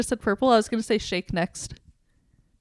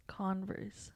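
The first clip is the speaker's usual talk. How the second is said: whispering; 3 semitones lower, 20 dB quieter than usual. Real, not acted. Puzzling over the clue word.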